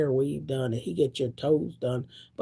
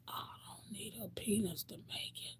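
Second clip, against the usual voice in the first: soft voiceless whisper; whispered take; 3 semitones higher, 19 dB quieter than usual. Real, not acted. To the audience, hushed private vow. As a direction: drop to a hush on the repeat. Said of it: "whispered repeat".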